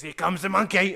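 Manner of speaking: high and loud, belted out; loud put-on voice, half laughing; mock announcement. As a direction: ham it up as a daft shout. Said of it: "he goes".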